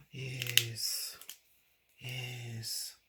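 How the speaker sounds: gasp, then a whispered yes; thrilled little gasp and whisper; delighted gasp. Real, not acted. Delighted gasp at silver marker.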